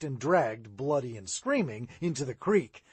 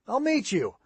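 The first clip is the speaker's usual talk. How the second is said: called back, higher and a bit louder; calling back; 7 semitones higher, 3 dB louder than usual. A narrator's reading. Chet answering Sid across the churchyard, calling. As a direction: toss it back over the distance. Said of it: "Chet called back".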